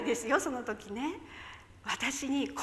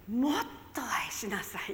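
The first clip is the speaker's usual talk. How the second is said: soft unvoiced whisper; whispered voice of the Lord; 3 semitones lower, 6 dB quieter than usual. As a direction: hush it like a voice heard inside. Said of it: "そして主が". (ささやき声で)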